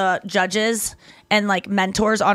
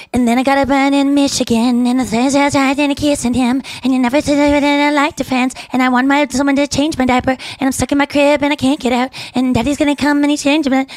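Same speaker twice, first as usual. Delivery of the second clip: put-on higher, louder babyish voice; baby talk; 4 semitones higher, 3 dB louder than usual. Pitching a song to will.i.am, baby talk. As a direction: babble it like a toddler in a crib. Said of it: "What's with the baby talk?".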